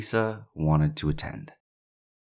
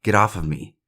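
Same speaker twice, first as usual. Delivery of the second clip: raised, mildly urgent retold cry for help; mock scream, a bit higher and only a touch louder; 4 semitones higher, 4 dB louder, slower than usual. Host quotes victim screaming on the phone, mild retold scream. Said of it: "Jasmine overheard Delisa screaming".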